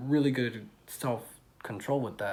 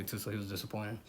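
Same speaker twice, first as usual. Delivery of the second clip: ordinary talk, nothing marked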